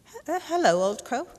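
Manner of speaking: high, stammering, startled greeting; nervous stammer. A narrator's reading. Slug brothers greet the crow, nervously stammering.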